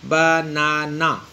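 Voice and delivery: Banana slowly split as ba, na, na; syllable by syllable. Shown as a demonstration. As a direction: stretch banana into three separate syllables. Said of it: "तो तीन इसमें हमने". (बनाना کو تین حصوں میں آہستہ توڑ کر بولیں)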